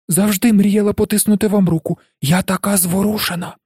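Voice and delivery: high-pitched gushing admirer; excited woman meeting her idol; thrilled. A narrator's reading.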